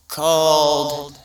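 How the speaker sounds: ordinary delivery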